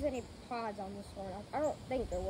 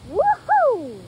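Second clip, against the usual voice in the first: excited; 10 semitones higher, 16 dB louder than usual. Real, not acted. Excited cheer in strong wind.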